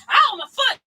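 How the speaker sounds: sudden high cry of pain; pained yelp. Real, not acted. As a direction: yelp as you stub your toe. Stubs foot grabbing water.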